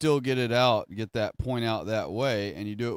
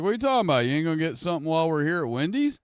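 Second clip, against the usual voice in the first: a friend asking in amazement, raised and quick; mock surprised friend; 6 semitones higher, much faster than usual. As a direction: pitch jumps high and fast in disbelief. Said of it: "they'll be like".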